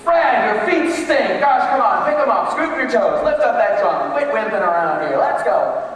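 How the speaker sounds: critical band director. Shown as a demonstration. Director scolding Fred for his sloppy marching. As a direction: snap at the drummer to pick it up. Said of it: "if I say Fred's our weak link".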